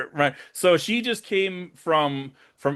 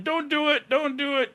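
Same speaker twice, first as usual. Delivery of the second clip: retold scream; 12 semitones higher than usual. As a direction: imitate a frantic screamed plea. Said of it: "Aaron screaming".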